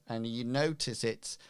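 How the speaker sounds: ordinary talk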